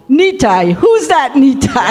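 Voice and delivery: high-pitched imitation of a baffled woman; mock puzzled woman. Imitating woman muttering back to car.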